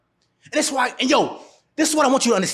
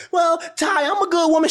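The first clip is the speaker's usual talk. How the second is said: higher, mocking imitation of a woman; mock woman's voice; 8 semitones higher than usual. Mocking a woman who insists she's a good woman. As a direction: parody her sweet claim, pitched up.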